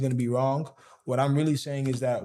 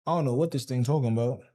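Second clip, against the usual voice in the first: ordinary talk, nothing marked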